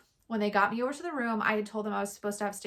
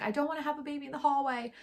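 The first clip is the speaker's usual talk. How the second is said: retold panic; 6 semitones higher, faster than usual. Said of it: "am freaking out".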